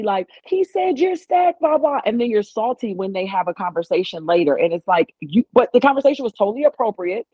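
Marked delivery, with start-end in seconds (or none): 0.4-2.0 high, drawn-out singsong call; imitated calling out. Mocking a friend who tattles to another woman, high mock call-out. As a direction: mimic someone calling out, pitched way up and slow. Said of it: "call out to A D".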